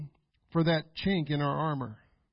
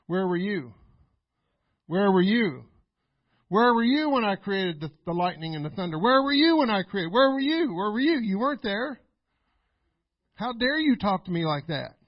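loud, building divine rebuke; God rebuking; 5 dB louder than usual. Retold or imitated. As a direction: thunder it down like an angry God. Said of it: "That's what he was saying".

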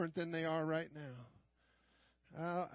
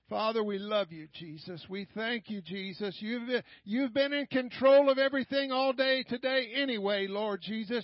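prayer building from restrained to loud and raised; swelling congregational prayer; fervent prayer; 3 semitones higher, 6 dB louder than usual. Real, not acted. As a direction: open tenderly to God, then build in force. Begins praying aloud again.

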